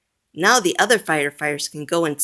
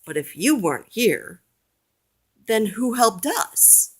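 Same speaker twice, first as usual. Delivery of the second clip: confused chief; 6 semitones higher than usual. A narrator's reading. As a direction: wonder aloud, baffled. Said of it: "The fire chief looks confused".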